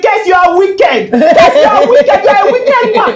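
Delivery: high, loud shouting with a laugh in it; wife shouting blame at her husband; angry accusation. Retold or imitated. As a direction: yell it at him, half laughing. Yelling at husband on phone.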